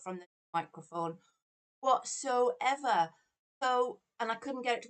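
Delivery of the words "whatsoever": "whatsoever" drawn out very slow, higher and louder; stretched emphasis. Real, not acted. Streamer finding the mic dead, dragging out "whatsoever". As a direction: lean hard on "whatsoever" in disbelief. No input from the microphone.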